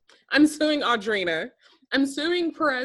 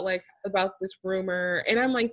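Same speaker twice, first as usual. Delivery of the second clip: ordinary talk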